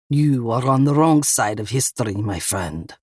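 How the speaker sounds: Russian gunman, voice sinking at the end; thick Russian accent. A narrator's reading. Russian gunman lectures cornered Eva, thick Russian accent. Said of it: "a man with a thick Russian accent".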